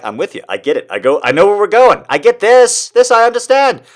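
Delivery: thrilled to finally get it; eager understanding. Retold or imitated. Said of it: "and I'm like".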